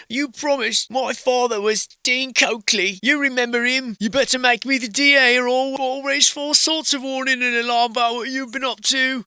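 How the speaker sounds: high, indignant British threat; British accent. Acted. Coakley's son demanding the DA job, mock British accent. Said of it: "I don't know why we're British".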